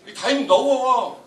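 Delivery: normal talking voice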